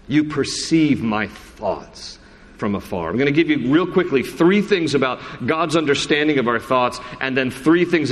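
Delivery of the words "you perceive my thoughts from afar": slow solemn repeat. Acted. Repeated slowly and softly.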